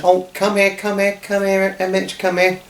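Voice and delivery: high, coaxing come here; mother calling the hurt child to her; sympathetic mother. Acted. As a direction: soothe the crying boy. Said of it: "sympathised and said".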